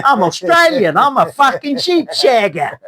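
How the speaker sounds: high, hammed-up Aussie twang; mock Australian accent. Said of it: "An Aussie speaks like this".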